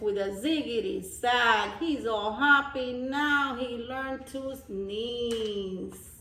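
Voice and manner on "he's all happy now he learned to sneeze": usual speaking voice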